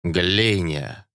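твёрдо и уверенно; произнеси заклинание уверенно; уверенным голосом. A narrator's reading. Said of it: "экзорцист уверенным голосом проговорил". (уверенно, как заклинание)

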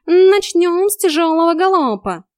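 high, loud, drawn-out drill order; bossy cat coach. A narrator's reading. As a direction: call the exercise like a trainer. Cat drills the chasing narrator.

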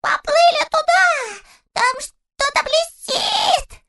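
high, slow, thrilled squeal of a small creature; squealing beside himself. A narrator's reading. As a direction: shriek it out in wild excitement. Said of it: "заверещал вне себя".

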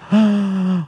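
performed gasp of awe; shocked gasp. Acted. The crowd seeing the royal carriage, startled gasp. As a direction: gasp as if caught out.

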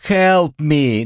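high demonstration call; mock cry for help. (показной крик о помощи)

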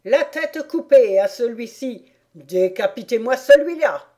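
a furious queen yelling across the field; queen shouting beheading orders at the players. A narrator's reading. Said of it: "qui criait".

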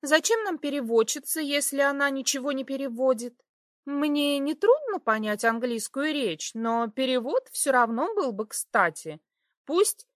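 put-on high sugary girly voice; sweetly feigned innocence; breathy cutesy giggly. A narrator's reading. Stesha voiced, innocent girl.

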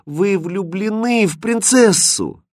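high, slow, drawn-out dismay; upset realisation, voice lifted high; distressed. A narrator's reading. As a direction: lament it slowly, heartbroken. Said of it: "огорчённо прошептала Катринка".

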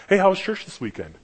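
high, needling mock-friendly question; lightly sarcastic greeting. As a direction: imitate a skeptic teasing a churchgoer. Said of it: "Kinda sarcastically, kinda".

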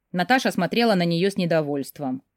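ordinary delivery, nothing marked

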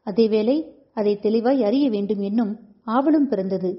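ordinary delivery, nothing marked

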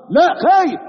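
high, loud exclaimed no; emphatic answer. Acted. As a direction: answer with sudden force. Preacher answering his own question.

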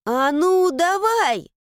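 sharp high shout, giving it everything. Acted. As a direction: yell with effort. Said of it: "крикнула Мила".

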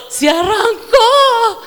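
high, loud, slow cry of joy; joyful amazement. Retold or imitated. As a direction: shout it in delighted disbelief. Joy car started.

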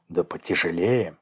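plain reading voice

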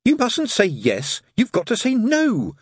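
high, slow, insistent coaching; a voiced mock hiss, drawn out. A narrator's reading. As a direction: correct the suspect insistently, pitched high. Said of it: "hissed the head torturer".

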